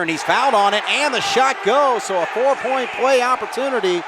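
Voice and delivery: high, loud excited play-by-play; esports caster. Real, not acted. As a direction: shout the big play call. And-one three called live.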